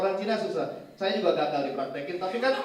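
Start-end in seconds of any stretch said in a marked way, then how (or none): none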